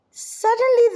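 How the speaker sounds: sudden high leap on the word; high, gasping Suddenly; dramatic suddenly. A narrator's reading. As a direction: make it a startling jolt. Dramatic turn in story.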